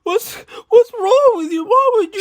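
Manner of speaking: put-on very high, tearful wail of a hurt boy; sobbing kid. Slapped friend sobbing at the narrator through tears. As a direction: cry the words like a betrayed child.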